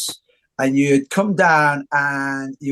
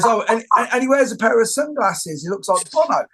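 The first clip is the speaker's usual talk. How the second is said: quoting Bugger; 6 semitones higher than usual. Retold or imitated. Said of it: "And he goes".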